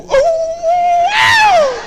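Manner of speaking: very high squealing ooh, laughing; a shocked dog howling ooh; dog's shocked ooh. Retold or imitated. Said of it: "even your dog go".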